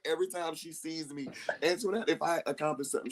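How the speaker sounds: ordinary talk, nothing marked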